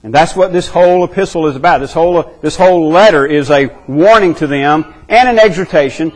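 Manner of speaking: ordinary delivery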